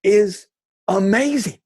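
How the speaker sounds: high, loud proclamation; emphatic praise. Retold or imitated. Stranger praising the man who gave cookies, high emphatic acclaim.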